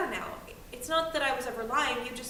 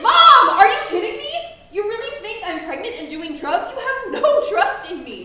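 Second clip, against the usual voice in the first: much higher, loud disbelieving shout; outraged yell; 10 semitones higher, 17 dB louder than usual. Acted. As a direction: scream back in outrage. Teen explodes in disbelief.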